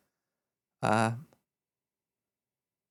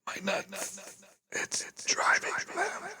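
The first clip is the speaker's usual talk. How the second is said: whisper; 3 semitones higher, 7 dB quieter, slower than usual. Acted. Whispered aside on the joke.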